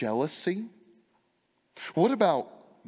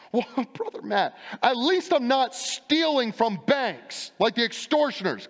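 mock excuse; 9 semitones higher, 4 dB louder than usual. Congregant excuse voiced by preacher.

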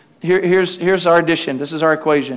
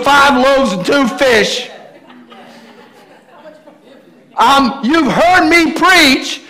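raised, drawn-out indignant protest; exasperated disbelief; 8 semitones higher, 4 dB louder, slower than usual. Acted. Jesus answering doubting Peter from the water, exasperated. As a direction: pile up the proof in mock outrage.

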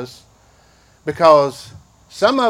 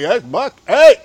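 louder, higher sputtering protest; protesting grandparent; 4 semitones higher, 6 dB louder than usual. Retold or imitated. Grandpa sputtering at someone insulting his grandbaby, mock offended.

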